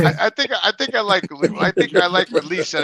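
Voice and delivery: loud, high, laughing through the words; real laughing. Real, not acted. Co-host reacting to the booth bit, laughing loudly.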